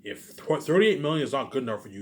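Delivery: ordinary delivery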